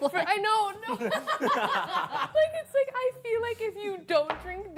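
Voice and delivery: high laughing talk. Real, not acted. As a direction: laugh through the words. Laughing through words.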